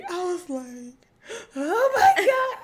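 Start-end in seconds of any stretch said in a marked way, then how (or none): 1.6-2.6 high, loud, slow sobbing laugh; a replayed wail of terror at the movie; retold crying. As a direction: relive the scare, crying and laughing at once. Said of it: "I was bawling my eyes out".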